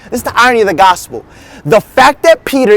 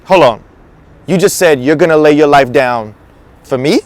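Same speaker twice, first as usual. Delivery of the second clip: low, measured disbelief as Jesus; skeptical questioning; 7 semitones lower than usual. Acted. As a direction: lower the voice and query the promise. Jesus questions Peter's vow.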